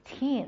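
put-on "teen" with a long ee and final n; long teen ending. Shown as a demonstration. Teacher recapping endings for learners, long clear teen. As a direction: draw out "teen". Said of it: "你说的是词尾的读法".

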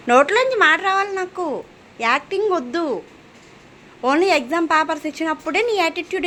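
high-pitched exasperated telling-off; real scolding. Real, not acted. Mother berates child for putting on act.

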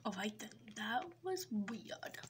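hushed, muttered aside; whispered aside. Acted. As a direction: whisper it to yourself. Aside after odd scene.

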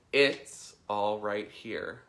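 slow mantra leaning on "It's"; "It's" stressed, slowly. Shown as a demonstration. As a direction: draw out and punch "It's", then ease through the rest. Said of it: "emphasize a different word".

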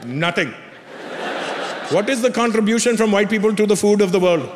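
put-on Indian-accented father, smugly making his case; imitated lecturing dad, Indian accent; dad's Indian accent. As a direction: quiz a son as an Indian dad. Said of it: "He goes".

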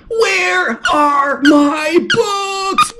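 loud, slow shout with a high pitch; very loud yell. A narrator's reading. Spencer chasing the thief, shouting loud and slow. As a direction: shout it across the yard. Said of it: "that's very loud".

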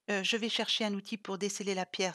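plain reading voice

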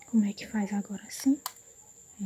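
hushed whisper while fiddling; whispered to herself. Real, not acted. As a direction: whisper it under your breath. Whispering while figuring out charger.

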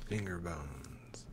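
slow creepy whisper; spooky whisper. Pretend. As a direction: whisper it like a ghost story. Spooky Halloween song idea.

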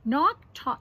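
ordinary delivery

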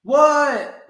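loud high startled what; startled answer. A narrator's reading. Froggy answers his dad, loud startled shout. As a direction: shout back, caught off guard.

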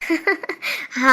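low, soft laugh; chuckle. Acted. Wife teased about night shifts, soft chuckle. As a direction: laugh quietly at the joke.

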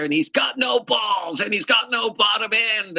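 high shouted complaint; mock outraged producer. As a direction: yell it down the phone. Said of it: "He says".

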